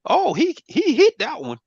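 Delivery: jumps way up high and loud in shock; floored, squeaky high exclamation; stunned disbelief. Retold or imitated. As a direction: gasp it out like you can't believe the trade hit. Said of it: "and I'm like".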